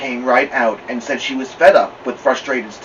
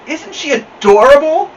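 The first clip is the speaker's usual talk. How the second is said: gushing; 13 semitones higher, 7 dB louder than usual. A narrator's reading. Neighbor gushing to friend.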